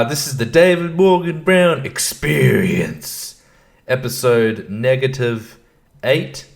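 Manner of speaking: slow, announcer-like show intro; a slightly hammed-up radio intro; podcast intro. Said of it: "podcast intro".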